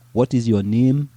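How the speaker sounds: mock African accent, name with a long e. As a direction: put on a light African accent. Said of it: "Do you want me to say".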